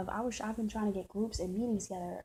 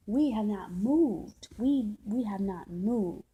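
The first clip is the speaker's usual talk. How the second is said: we have not moved, slow and loud; slow emphasis; 4 dB louder, much slower than usual. Real, not acted. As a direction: hammer each word slowly.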